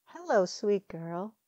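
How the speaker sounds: put-on slow, tender coo to a child. A narrator's reading. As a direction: coo it gently, slowly. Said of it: "she cooed".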